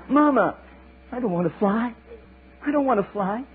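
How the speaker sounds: high, pleading little eaglet; frightened child. Acted. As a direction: beg not to be made to fly. Eaglet refusing to fly.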